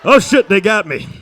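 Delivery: loud action-hero shouting; loud hammed-up movie hero; mock heroic last stand. Acting out heroic zombie scene.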